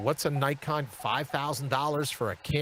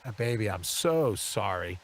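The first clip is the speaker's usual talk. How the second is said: sympathetic wife; 9 semitones lower than usual. Retold or imitated. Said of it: "And Ashley's like".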